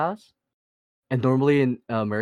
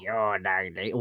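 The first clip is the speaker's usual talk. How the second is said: mocking Toronto accent, pitched lower; Toronto slang accent; 7 semitones lower, 7 dB quieter, slower than usual. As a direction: caricature a Toronto man's slang. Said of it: "they make themselves sound like they're, like, rats".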